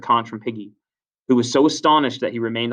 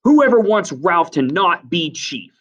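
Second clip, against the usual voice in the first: loud, slow, higher-pitched demand; strong command; 7 semitones higher, 6 dB louder, slower than usual. A narrator's reading. Jack demands votes against Ralph, strong and loud. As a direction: spell out the vote forcefully. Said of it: "said Jack strongly".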